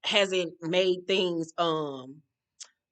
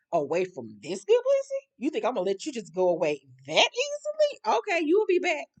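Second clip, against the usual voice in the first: mock disbelief; 7 semitones higher, 3 dB louder than usual. Gloria to the lover leaving her, mock scornful.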